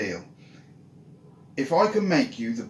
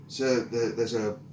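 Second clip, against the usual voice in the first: ordinary delivery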